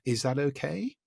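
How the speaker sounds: rising approval-seeking tone; approval-seeking rise. Shown as a demonstration. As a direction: ask it like you need permission. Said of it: "with that tone of voice".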